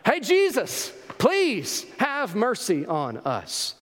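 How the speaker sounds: high, urgent call, then drawn-out plea; pleading call, stretched out slowly at the end. Acted. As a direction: shout for his attention and beg. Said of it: "They just cry louder".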